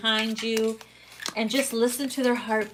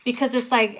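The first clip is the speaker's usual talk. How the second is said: normal talking voice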